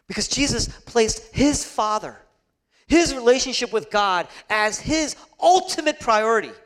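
forceful sermon delivery; impassioned preaching. Acted. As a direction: drive each phrase home, high and loud.

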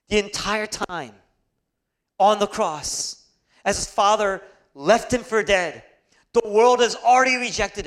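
emphatic pulpit delivery; impassioned preaching. Acted. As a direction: build forcefully to the last phrase. Preacher at full force.

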